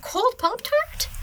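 very high, slow questioning pet voice; high, mock-puzzled animal voice; pet's voice. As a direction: speak for a pet, squeaky and drawn out. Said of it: "He says".

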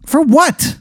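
very high-pitched, incredulous question; mock indignant. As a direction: pitch leaps up in play-acted disbelief. Told he is banned from gym.

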